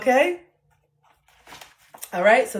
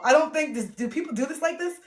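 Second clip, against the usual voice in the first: ordinary talk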